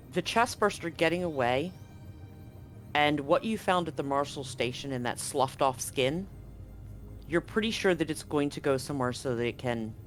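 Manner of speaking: usual speaking voice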